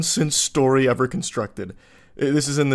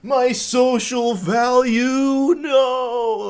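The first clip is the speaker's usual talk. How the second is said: much higher, louder wail, slow on the middle; melting victim; 11 semitones higher, 6 dB louder, much slower than usual. Pretend. Patted guy losing his social value, hammed-up wail. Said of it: "he's like".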